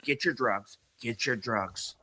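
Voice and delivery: soft, slowing mock urgent wife; wife urging. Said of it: "my wife's like".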